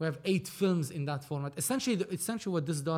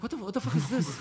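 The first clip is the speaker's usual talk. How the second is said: baffled; 3 semitones higher, faster than usual. Retold or imitated. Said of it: "And he goes like".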